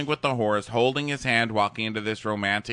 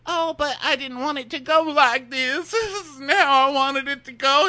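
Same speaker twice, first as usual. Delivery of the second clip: put-on very high, squeaky pleading girl voice; mock whiny plea; 14 semitones higher, 3 dB louder than usual. Friend mocking bachelorette clinging to his arm, high whiny plea. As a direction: beg in a thin, high whine. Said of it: "She's grabbing his arm and like".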